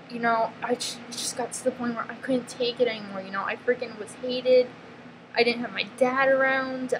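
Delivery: ordinary delivery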